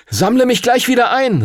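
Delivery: a high, ringing call over the rushing air. Acted. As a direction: shout it up to the dragon. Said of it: "rief er Phoenix zu".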